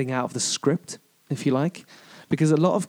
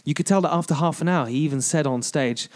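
normal talking voice

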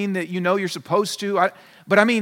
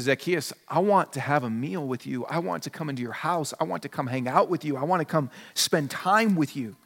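gentle Jesus; 5 semitones lower, 5 dB quieter than usual. Retold or imitated. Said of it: "He says".

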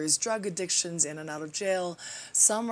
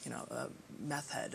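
low, much softer, ashamed confession; ashamed hush; 5 semitones lower, 6 dB quieter than usual. Retold or imitated. A parent confessing their child's troubles, low and hushed in shame. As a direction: drop low and quiet as if admitting something shameful.

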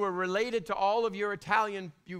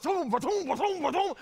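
higher, louder beat sounds; mock loud music; 9 semitones higher, 4 dB louder than usual. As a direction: imitate booming music leaking from earphones. Imitating loud earphone music.

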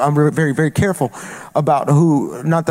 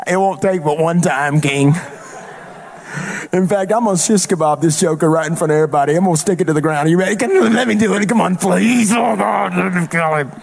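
higher, pleading country voice; 3 semitones higher than usual. Retold or imitated. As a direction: beg to strike like a wild man. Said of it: "He's foaming".

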